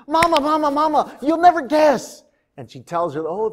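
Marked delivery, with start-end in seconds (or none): 0.1-2.2 put-on high, excited little girl bursting with news; can't wait to tell. Miriam reaches her mother, high and excited.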